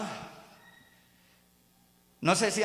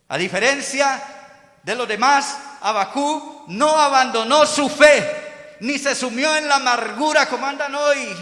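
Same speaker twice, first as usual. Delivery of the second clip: high, loud pulpit delivery; emphatic preaching; 7 semitones higher, 8 dB louder than usual. Real, not acted. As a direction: drive each phrase home to the congregation. Preaches at full force.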